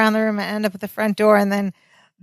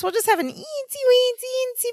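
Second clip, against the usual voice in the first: put-on squeaky high baby talk; cutesy high voice; 5 dB quieter than usual. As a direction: squeak the eensy weensy bits.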